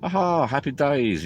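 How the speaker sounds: high, slow cheerful aha; mock delight at a sunny morning; hammed-up happy sigh; happy wake-up. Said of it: "you go".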